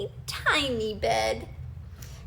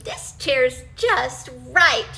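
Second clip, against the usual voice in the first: satisfied, slow; 6 dB louder, slower than usual. Acted. Goldilocks settles contentedly.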